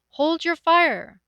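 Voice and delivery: high, loud, slow plea; calling loudly. Acted. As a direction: shout it out slowly to be heard. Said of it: "she called loudly".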